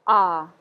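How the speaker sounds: loud, isolated "ah"; French A sound. Shown as a demonstration. Teacher repeating the first single vowel, demonstrated sound. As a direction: model "ah" clearly, open as at the doctor's. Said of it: "which is pronounced ah".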